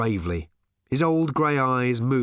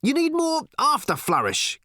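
much higher, insistent retort; countering. A narrator's reading. Ford argues with Arthur over towel technique, countering. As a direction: throw the argument straight back, pitched high. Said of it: "countered Ford".

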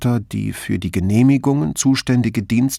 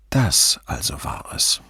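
whispered realisation; 7 semitones lower than usual. A narrator's reading. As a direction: whisper it to yourself.

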